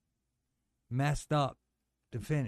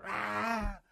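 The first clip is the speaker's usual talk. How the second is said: mock yell; 7 semitones higher than usual. Retelling his ring entrance yell.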